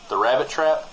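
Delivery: normal talking voice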